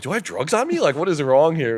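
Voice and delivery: high, loud panicked questioning; loud, high retold protest; mock panic. Panicked self acted out.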